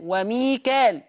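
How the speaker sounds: وميكال with plain long alif, no hamza; long aa straight into lam in وميكال; Hafs reading. Shown as a demonstration. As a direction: say وميكال ending in -kaal. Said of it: "حفص يقرأ بالألف".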